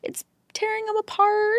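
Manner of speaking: high mocking voice; mock-whiny complaint; mocking opponents.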